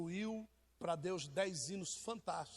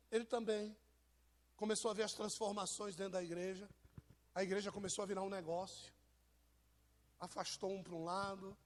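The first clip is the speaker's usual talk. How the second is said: ordinary talk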